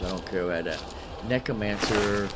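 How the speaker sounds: ordinary talk, nothing marked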